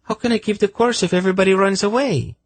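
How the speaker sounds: high, fast protesting female voice; objecting. A narrator's reading. Senedra protesting as everybody deserts her, high and indignant. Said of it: "she objected".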